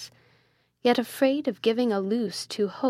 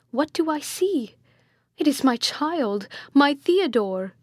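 higher-pitched, astonished exclamation; startled cry; 6 semitones higher, 4 dB louder than usual. A narrator's reading. Friar suddenly recognizes his own son, a startled cry. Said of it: "cried the holy man, starting".

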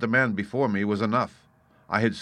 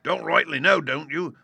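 high, loud scornful echo of an answer; mocking cry; 8 semitones higher, 3 dB louder than usual. A narrator's reading. Landlord mimicking Morgan's excuse, mocking. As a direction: throw his words back at him. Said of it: "cried Long John".

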